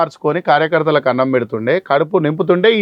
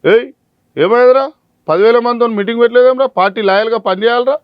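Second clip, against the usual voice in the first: bossy leader yelling; 8 semitones higher, 4 dB louder than usual. Retold or imitated. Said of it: "ఒకడు phone చేస్తాడు".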